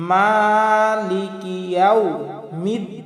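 slow "মালিকি ইয়াউমিদ্দিন", words distinct, long vowels; measured recitation. Shown as a demonstration. Teacher modelling separate words, slow measured recitation. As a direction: hold MA and DIN, sound the KI. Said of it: "উচ্চারণ করব আলাদা আলাদাভাবে".

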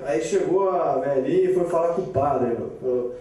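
ordinary talk, nothing marked

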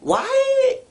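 a sudden high squeaked why; high mock squeal. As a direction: squeak it out in disbelief. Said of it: "you just wanna be like".

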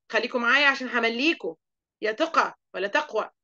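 ordinary talk

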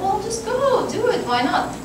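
high, coaxing nudge to go; breezy urging. Retold or imitated. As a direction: imitate someone egging a friend on, pitched high. Someone urging her to go.

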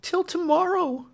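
high, pressing plea; urging. A narrator's reading. Clemency urging Marian to wait, high and pleading. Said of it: "urged Clemency".

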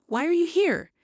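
sister-in-law greeting her coldly; hostile sister-in-law. A narrator's reading. Sister-in-law confronting the visitor, hostile.

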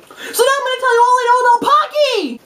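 high, excited holler; big high-pitched build-up; hyped-up announcement. Real, not acted. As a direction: announce it with over-the-top excitement.

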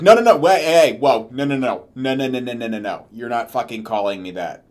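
loud, rapid stream of no's that fades out; shutting it down. Pretend. A new dad refusing to be called daddy by his mom, loud. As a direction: stop her cold, then trail off. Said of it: "I'd be like".

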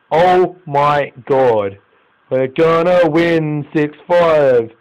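very slow, stretched-out exclamation; slow-motion exasperated wail; drawn-out disbelief. Real, not acted. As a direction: drag every word out in disbelief. Reacts to heavy conceding.

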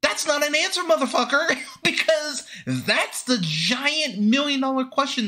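high, laughing disbelief; exasperated laughter at the movie; laughing exasperation. Real, not acted. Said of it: "laughing exasperation".

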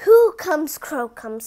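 ordinary delivery

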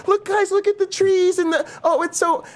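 high, lightly mocking lecturer; mocking biologists. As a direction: parody a gushing biologist. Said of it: "biologists do that".

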